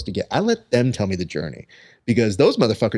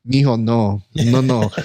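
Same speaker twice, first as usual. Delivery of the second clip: Mexican taco man; 4 dB louder, slower than usual. Retold or imitated. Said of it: "right there being like".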